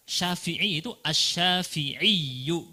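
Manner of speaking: slow asy-Syafi'iyyu with doubled yy; tasydid reading. Shown as a demonstration. Teacher modelling the full ending for students. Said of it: "ada tasydidnya sebenarnya".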